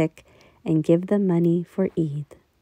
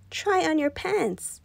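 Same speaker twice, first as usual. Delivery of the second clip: high, slow coaxing; lightly voiced wife; wife urging; 10 semitones higher, 4 dB quieter, slower than usual. A narrator's reading. Mother urges.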